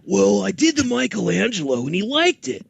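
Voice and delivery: Michelangelo voice. Acted. Recalls doing Michelangelo audition.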